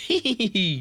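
very high "hee-hee" giggle; high-pitched acted happy laugh. A narrator's reading. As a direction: squeal out a thin giggle. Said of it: "Ödönke boldogan felnevetett, így".

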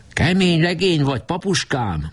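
put-on high, proud young boy voice. A narrator's reading. Boy proudly praising the president, high and proud. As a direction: puff up like a proud little boy. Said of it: "mondta csónakos büszkén".

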